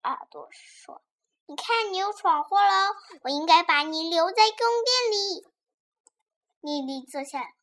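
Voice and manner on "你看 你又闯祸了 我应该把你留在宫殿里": louder, raised scolding voice; mildly cross, projected reproach. Acted. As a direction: tell off a naughty pet. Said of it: "莉莉揪着普洛克的 耳朵说".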